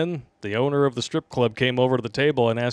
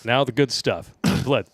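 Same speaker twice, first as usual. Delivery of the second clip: ordinary talk, nothing marked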